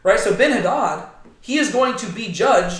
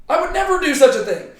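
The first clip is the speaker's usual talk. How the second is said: indignant denial; 9 semitones higher, 4 dB louder than usual. Retold or imitated. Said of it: "notice that he doesn't say".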